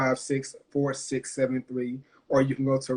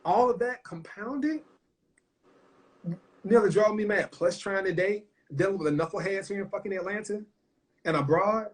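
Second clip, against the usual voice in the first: pouring out her struggles, higher and strained; raw emotion; 5 semitones higher than usual. Real, not acted. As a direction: voice pushed higher, close to tears.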